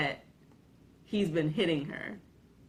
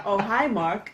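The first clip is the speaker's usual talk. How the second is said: imitated awkward, raised cheery hello; hammed-up, louder, higher greeting; bad actor's greeting; 4 semitones higher, 5 dB louder than usual. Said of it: "he says that to someone".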